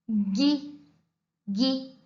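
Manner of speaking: usual speaking voice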